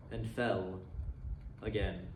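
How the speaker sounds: measured storytelling voice, reading a classic aloud; story narration. A narrator's reading. Narrator reading A Christmas Carol to listeners, storytelling voice. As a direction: tell it like a fireside ghost story.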